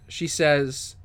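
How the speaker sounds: ordinary delivery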